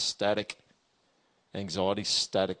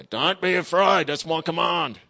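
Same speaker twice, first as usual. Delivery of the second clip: slow, loud, higher commanding bark; drill sergeant king; 7 semitones higher, 8 dB louder, slower than usual. Pretend. God as drill sergeant king orders his people, slow loud bark. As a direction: give it as a royal order nobody dares question. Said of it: "like a drill sergeant king".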